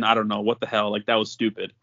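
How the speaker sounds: usual speaking voice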